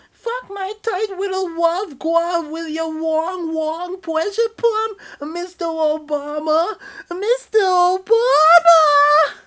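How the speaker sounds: shrieking falsetto climax; high moaning lover. Acted. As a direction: builds to a screaming high pitch. Lover character voice.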